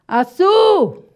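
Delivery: Açu with SU called out high, loud and long; calling the stressed syllable. Shown as a demonstration. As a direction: call out Açu, stretching the SU. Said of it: "Vamos achar a sílaba tônica de açúcar".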